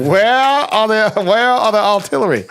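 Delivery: high, laughing question. Real, not acted. Guest jokes about movie artillery, laughing. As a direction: giggle the question out.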